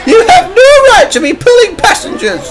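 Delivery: very high, loud angry mother voice; outraged mum telling someone off; cross shout. A narrator's reading. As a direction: shout it crossly in a piercing falsetto. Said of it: "shouted the boy's mother".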